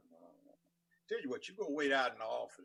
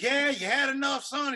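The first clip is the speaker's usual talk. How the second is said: loud taunting judge; 10 semitones higher, 7 dB louder than usual. Retold or imitated.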